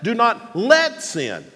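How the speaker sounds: slow phrase with "let" punched high; drawn-out, emphatic let; stress on LET. Shown as a demonstration. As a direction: hit let hard, then drop on sin. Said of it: "the next word is the word "let".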